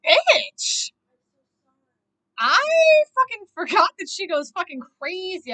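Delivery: loud, high, real exclamation; worked-up outburst. Real, not acted. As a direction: burst out, thoroughly riled. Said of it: "I get so worked up".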